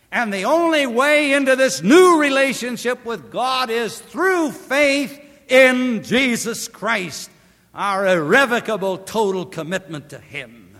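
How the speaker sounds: fiery revival preacher at the climax; full-force preaching. Acted.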